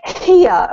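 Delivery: here with a strong breathy h; breathy h starts. Shown as a demonstration. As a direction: push air on the h of here. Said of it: "This is a really breathy H sound".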